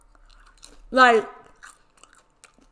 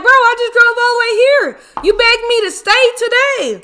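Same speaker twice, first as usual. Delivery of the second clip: much higher and louder, fed-up protest; indignant outburst; 9 semitones higher, 4 dB louder than usual. Retold or imitated. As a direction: throw it back at him, fed up.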